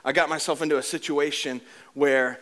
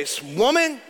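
mock rebuke; 9 semitones higher than usual. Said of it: "No, I'm just kidding".

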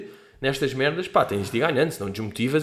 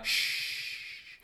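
hushing shh; 12 dB quieter than usual. Acted. Hushing a friend.

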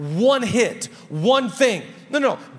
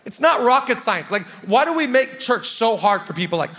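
ordinary talk, nothing marked

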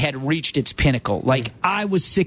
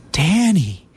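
higher, gentle coaxing imitation of a friend; calming high voice; 2 semitones higher, slower than usual. Producer mimics his roommate coaxing him, high and calming. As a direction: soothe him out of skipping dinner. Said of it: "J D in that voice, that calming, high voice".

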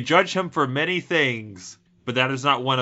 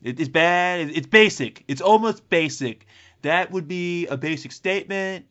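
louder, higher Trump riff; a light Trump impression, louder and higher; 7 semitones higher, 5 dB louder than usual. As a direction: boast it like Trump. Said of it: "Trumpy vibes".

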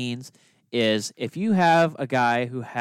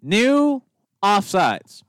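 high, loud, drawn-out announcement; dramatic reality show hype; 7 semitones higher, 5 dB louder, much slower than usual. Pretend. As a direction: build it up like a TV reveal. Mock big announcement of rule.

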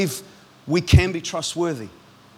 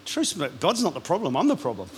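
ordinary talk

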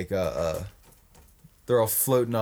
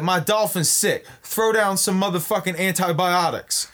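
mock [name]; 7 semitones higher than usual. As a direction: yell it down from the dolphin. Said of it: "he's like".

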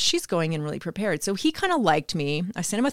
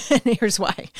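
laughing; 6 semitones higher, slower than usual. Retold or imitated. Laughs while retelling her pitch.

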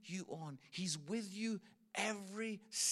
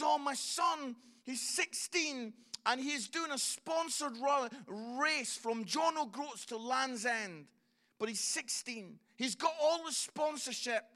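higher, louder, urgent father pleading; excited dad; 6 semitones higher, 6 dB louder than usual. Retold or imitated. Father asking strangers for help, high and urgent. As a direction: rush it out like a frantic dad. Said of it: "He says".

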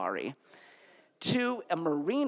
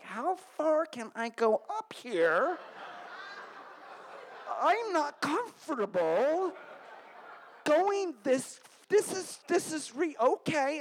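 higher, slow, flustered unsure voice; nervous teen self; 10 semitones higher, slower than usual. Retold or imitated. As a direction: squeak it out nervously. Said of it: "And I was like".

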